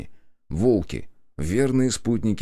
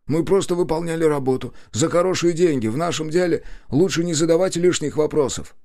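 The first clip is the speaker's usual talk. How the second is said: higher-pitched bandit voice; bandit answering questions; 9 semitones higher, 3 dB louder than usual. A narrator's reading. Bandit defends himself in dialogue.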